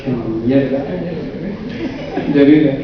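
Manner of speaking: low, soft, defeated mumble; depressed delivery. Shown as a demonstration. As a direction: sink into it, head down and to the right. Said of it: "y decirlo así".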